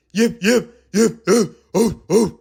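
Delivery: loud yips; little dogs yipping. Acted. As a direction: yip like small dogs. Smaller dogs bark.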